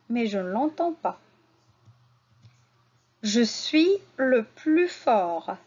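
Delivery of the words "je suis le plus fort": "Je suis le plus fort" said slowly; slow model sentence. Shown as a demonstration. Teacher models the sentence slowly for pupils. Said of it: "À la fin du mot suis".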